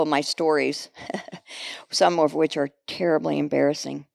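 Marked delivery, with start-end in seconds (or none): none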